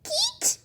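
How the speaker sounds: high harsh "keech" squawk; myna screech imitation. Guide imitating a myna's harsh call.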